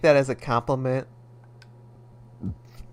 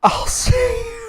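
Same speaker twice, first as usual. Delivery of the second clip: squeaky high line; 4 dB louder than usual. Acted. Squeaky voice while reading a game line.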